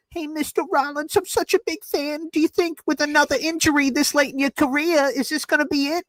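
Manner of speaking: put-on high, piping young fan voice; squeaky kid asking his hero; twelve-year-old kid. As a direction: put on a little boy calling a radio show. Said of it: "A twelve-year-old kid called the Rich Eisen show".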